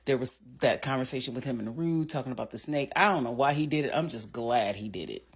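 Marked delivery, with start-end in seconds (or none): none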